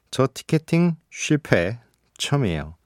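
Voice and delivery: ordinary talk, nothing marked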